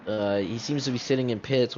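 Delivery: ordinary talk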